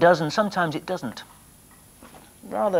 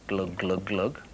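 1 glug sound effect; 8 semitones lower, 7 dB quieter, slower than usual. Shown as a demonstration. Bottle filling with water.

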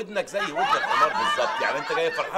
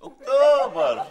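mocking echo; 5 semitones higher, 7 dB louder, much slower than usual. Mocking his answer.

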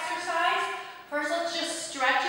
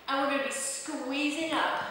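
usual speaking voice

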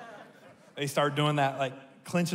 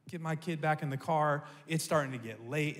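ordinary delivery

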